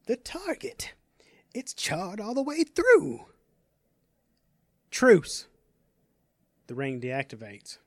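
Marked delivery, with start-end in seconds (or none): 0.1-3.3 put-on high, whispery old man's excitement; excited grandfather. Grandfather sees the ring's new power, excited. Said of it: "his grandfather said excitedly". 4.9-5.5 called command. Acted. Power word ends the demonstration.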